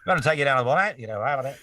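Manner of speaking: a thick Aussie bloke talking to a mate; Aussie accent. Retold or imitated. Imitating the Australian father-in-law in an Aussie accent. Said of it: "Can I hear more of that Aussie accent".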